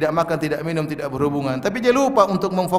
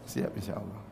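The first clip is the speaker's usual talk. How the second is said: a much softer, muted answer to his own question; reply softly as a hesitant listener; 9 semitones lower, 16 dB quieter than usual. Pretend. Preacher answering his own question for the congregation, muted reply.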